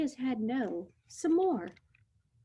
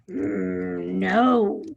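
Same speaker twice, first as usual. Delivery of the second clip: rumbling voice; 2 semitones lower, 5 dB louder than usual. Acted. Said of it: "in his rumbling voice".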